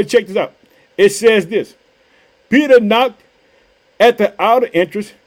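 normal talking voice

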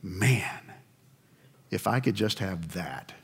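soft, longing murmur under the breath; a man wishing to himself as a woman walks by, quiet; lustful murmur. Retold or imitated. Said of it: "and you go".

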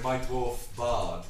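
normal talking voice

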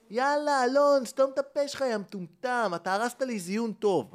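put-on cheating girlfriend yelling at her partner, scornful and loud; angry retort. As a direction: tell him to shut up with scorn. Girlfriend snapping back at Alon.

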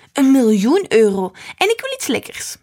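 loud, high playful demand; child's bold demand. A narrator's reading. Granddaughter bargaining with grandpa, loud playful demand.

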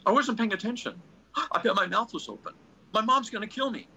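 high, fretting wife voice with a gasp; panicky wife. Retold or imitated. Wife fretting to her husband about the photo, high and anxious.

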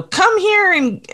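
high, drawn-out polite phone voice; mock telephone manners, slow and high; phony phone voice. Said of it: "using her phony phone voice".

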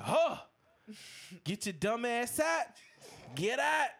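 mock yell to get out; mock shout. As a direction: chase him out the door, hammed-up. Prince kicking him out.

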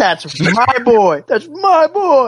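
slow, high, drawn-out proud praise; proud dad. Retold or imitated. Friends echoing Din praising his son: slow proud dad. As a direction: stretch it out like a beaming father.

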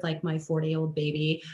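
ordinary talk, nothing marked

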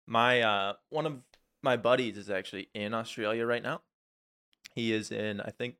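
ordinary delivery

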